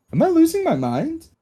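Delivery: high, alarmed question; panicked. Acted. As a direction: ask it in a rising panic. Panicked player asks table.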